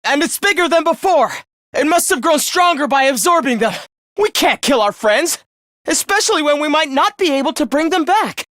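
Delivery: high, urgent alarm; urgent, worried and high-pitched. Acted. Alarm over the growing enemy.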